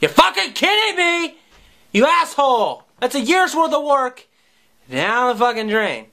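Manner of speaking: hammed-up kid outrage; mock outrage. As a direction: blow up at him in fake fury. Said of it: "as if to say".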